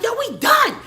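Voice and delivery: very high, loud dramatic tirade; re-enacted hysterical yelling, over the top; freaking out. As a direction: shout a hammed-up furious breakup. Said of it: "I'm freaking out".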